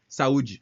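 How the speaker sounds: saúde dit à la brésilienne, sa-u-de; Portuguese saúde. Shown as a demonstration. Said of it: "c'était plus facile pour moi de dire". (saúde prononcé en portugais brésilien)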